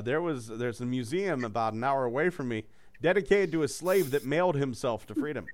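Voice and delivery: ordinary delivery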